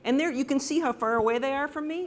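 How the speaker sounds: ordinary talk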